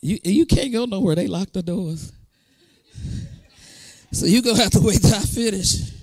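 soft, fast joking aside; teasing aside. Real, not acted. Preacher teases congregation about locked doors, soft quick joke. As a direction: rib them that they're locked in. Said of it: "teasing the congregation".

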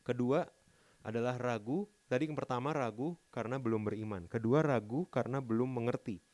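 preacher raising the volume; forceful preaching. Real, not acted. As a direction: preach it out to the congregation. Preaching next point loudly.

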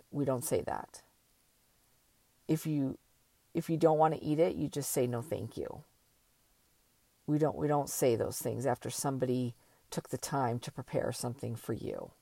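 retold soft, low reproof to a child; gentle parental correction. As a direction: quietly imitate a parent teaching manners. Daughter gently correcting her child.

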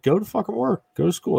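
high, brash imitation of officials; lightly voiced dismissive authority; mock official. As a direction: sneer it like a careless government. Imitating Sweden's government.

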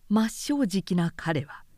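usual speaking voice

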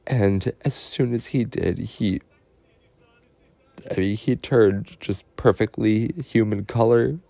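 fighting back tears while speaking of the birth; getting emotional. Real, not acted. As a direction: voice lifting, choked up with real emotion. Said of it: "I'm sorry for getting emotional".

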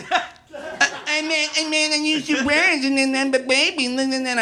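high, babbling mock baby talk. Brian copying the narrator to mock him, high baby voice. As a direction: mimic someone's stammer in a high baby voice. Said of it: "copying me in a baby voice".

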